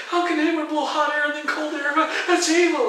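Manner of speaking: very high, loud mock outrage; mock horror. Teacher parodying his superstitious student, high mock horror. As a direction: cry out in hammed-up alarm.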